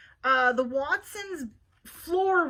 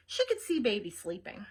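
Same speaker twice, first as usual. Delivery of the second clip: ordinary delivery, nothing marked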